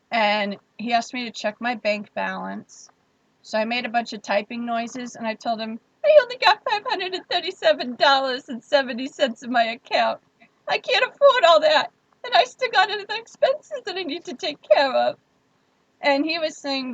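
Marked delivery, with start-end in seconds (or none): 6.0-15.2 high, pleading sob-story voice, much higher and louder; mock distraught. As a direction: play up a fake breakdown over money. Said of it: "all the emotional trauma in my voice".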